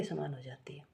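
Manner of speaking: unvoiced, soft whispered reply; whispered answer. Shown as a demonstration. Teacher repeats the answer.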